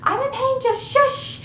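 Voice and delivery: high, drawn-out mock snap at mom; snapping. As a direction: imitate yourself snapping in a high strained voice. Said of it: "I'm snapping at my mom".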